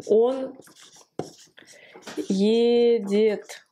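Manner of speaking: едет stretched, with a long pause before it; sounding out slowly. Shown as a demonstration. Teacher writing out il va, slow syllable-by-syllable. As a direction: hold each syllable of едет.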